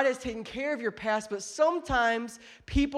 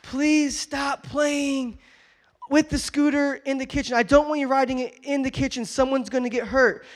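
retold parental shout; 5 semitones higher, 6 dB louder than usual. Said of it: "I said".

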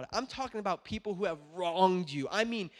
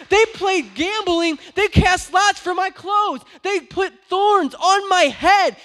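full-force high shout, slow and drawn out on each phrase; passionate preaching; 12 semitones higher, 16 dB louder, slower than usual. Real, not acted. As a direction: cry it out to the whole church at full volume. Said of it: "I'm getting so passionate".

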